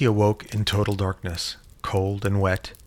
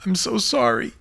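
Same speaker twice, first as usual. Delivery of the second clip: crackling voice; 12 semitones higher, 3 dB louder than usual. Acted. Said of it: "apologized with a crackling voice".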